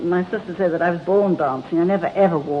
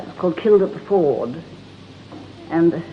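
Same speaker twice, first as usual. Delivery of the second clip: ordinary talk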